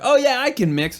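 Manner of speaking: high, breezy mock boast; overconfident amateur. Quoting overconfident amateurs who claim skills.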